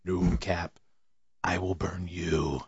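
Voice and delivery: whispery spooky threat, drawn out; threatening Ouija board. Retold or imitated. Ouija board threatening dad, hushed slow menace. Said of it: "I put my own inflection on that".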